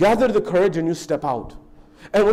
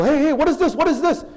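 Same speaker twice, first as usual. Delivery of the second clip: a higher, wide-eyed hey, hey; amazed question; 9 semitones higher than usual. Acted. Newcomer asks townspeople, amazed. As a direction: call out like a stranger seeing a city. Freed prisoner marvels at city.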